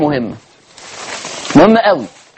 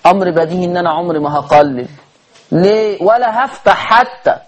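usual speaking voice

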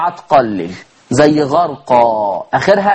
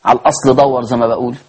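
normal talking voice

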